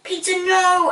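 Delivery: high, loud dismay; wife's shocked protest; appalled. A narrator's reading. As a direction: object in horror to your husband. Wife appalled at husband.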